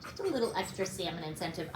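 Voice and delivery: ordinary talk, nothing marked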